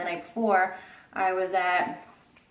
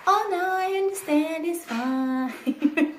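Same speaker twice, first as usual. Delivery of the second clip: much higher, airy reassurance; mock doctor; 7 semitones higher, slower than usual. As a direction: parody his easygoing reply. Mimics doctor's reply.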